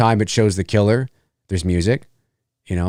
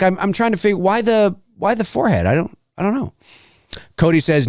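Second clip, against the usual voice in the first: ordinary talk, nothing marked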